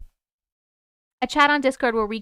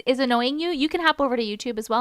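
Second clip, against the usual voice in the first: ordinary delivery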